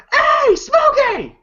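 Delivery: high, slow drawn-out shout; calling out. Retold or imitated. Neighbor hollering to Smokey, drawn-out call. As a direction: holler across the street.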